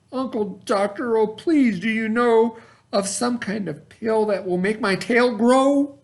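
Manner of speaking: high, begging little bird voice; a high, desperate plea to her uncle; pleading cry. Acted. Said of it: "and she cried".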